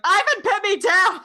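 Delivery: high, loud yell of protest; Shiloh yelling. Acted. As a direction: shout it as if hoisted off the ground. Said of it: "is Shiloh yelling".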